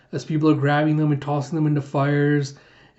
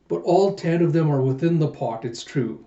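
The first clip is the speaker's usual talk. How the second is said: ordinary talk, nothing marked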